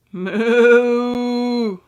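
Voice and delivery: loud, drawn-out moo; cow mooing. Acted. Cow lumbering out from the hedge, loud moo. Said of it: "a cow lumbered out".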